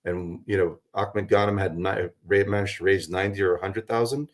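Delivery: ordinary talk, nothing marked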